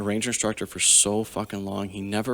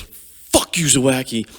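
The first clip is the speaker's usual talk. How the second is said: much higher, much louder angry yell; retold furious scream; 4 semitones higher, 7 dB louder, slower than usual. Retold teammate screaming at him after being clipped, furious yell. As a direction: bellow it like a teammate who just got elbowed in the head. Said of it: "he's screaming at me".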